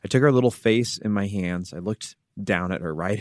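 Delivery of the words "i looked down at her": usual speaking voice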